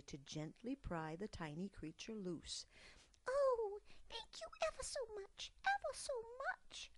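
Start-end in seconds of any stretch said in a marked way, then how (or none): 3.3-6.9 very high, fast grateful mouse; gushing mouse. Acted. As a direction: gush with relief. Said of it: "gushed Dimity".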